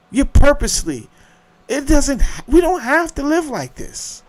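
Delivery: rising indignant rant; heated outburst. Real, not acted. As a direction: voice shooting high and loud in outrage. Escalating rant about anxiety.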